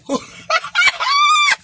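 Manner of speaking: very high, loud burst of laughter; shrieking laugh. Real, not acted. Shrieking with laughter at her own bedroom story.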